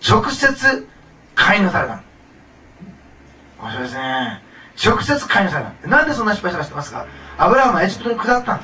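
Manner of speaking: loud, high-pitched preaching with punched key words; emphatic preaching. Real, not acted. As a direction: drive the point home loudly to the congregation. Preaches with full force. (力強く声を張って説教する)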